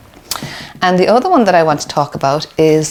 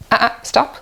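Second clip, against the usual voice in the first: dismissive parent; 8 semitones higher than usual. Shown as a demonstration. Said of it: "Imagine the difference".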